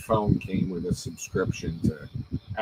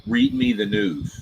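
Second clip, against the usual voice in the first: telling the phone; 12 semitones higher than usual. Retold or imitated. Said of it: "I'll say, "Hey".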